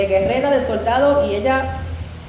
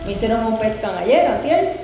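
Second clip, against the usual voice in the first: ordinary talk, nothing marked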